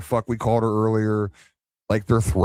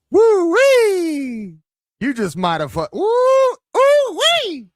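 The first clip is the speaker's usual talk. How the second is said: high, loud hooting woo-wee; excited whoop; 6 dB louder, slower than usual. Real, not acted. Streamer whooping at a doorbell threat, loudly excited. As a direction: whoop it up at the top of the voice.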